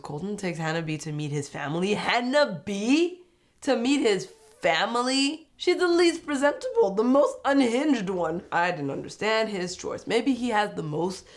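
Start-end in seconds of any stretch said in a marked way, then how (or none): 1.9-5.5 slow, high, rising disbelief; stretched-out, questioning incredulity; incredulous confusion. Real, not acted. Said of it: "I was super confused". 5.6-8.4 high, loud incredulous protest; worked-up, high-pitched astonishment; baffled disbelief. Real, not acted. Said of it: "I was super confused".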